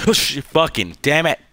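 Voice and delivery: loud, slow startled curse; startled yell. Real, not acted. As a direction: yell at a jump scare. Jump scare in horror game.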